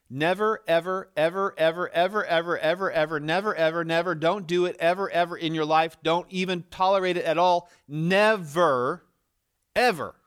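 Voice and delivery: loud, high, insistent string of nevers; emphatic repetition. Retold or imitated. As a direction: hammer every ever home with rising force. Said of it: "He is emphatically saying".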